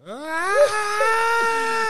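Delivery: high, sharp scream of pain; retold pained scream. Friend imitating his buddy's pained scream. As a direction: cry out like a guy crashing through the floor.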